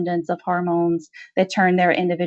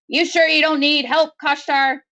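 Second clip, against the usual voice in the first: raised, higher voice to be heard; warden calling out; 7 semitones higher than usual. Acted. Warden calls to Maudie over the wail, raised and higher. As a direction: shout a concerned question across the room.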